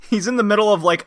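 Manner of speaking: ordinary delivery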